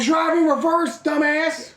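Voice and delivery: slow, high, loud shout; mocking Rick Dalton yelling at the hippies; mock furious yell. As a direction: bark it slowly like an angry homeowner.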